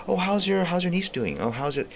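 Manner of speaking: high-pitched pleasantry voice, put on; hammed-up high small-talk voice; mock small talk. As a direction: parody polite chit-chat way up high. Mocking small talk with a stranger.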